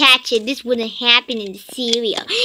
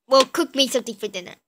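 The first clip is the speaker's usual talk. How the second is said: usual speaking voice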